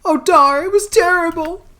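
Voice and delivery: put-on high, loud frightened young woman; distressed daughter. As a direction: blurt it out, still shaken. Daughter tells of the attack.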